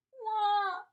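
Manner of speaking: loud, high terrified "waaah"; full-voiced storybook wail; yelled baby wail. A narrator's reading. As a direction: bawl in alarm like a baby. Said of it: "Finn yelled".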